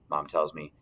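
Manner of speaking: usual speaking voice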